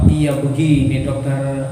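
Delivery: low, hesitant voice; meek wife. Acted. Wife starting to confess to doctor, meek.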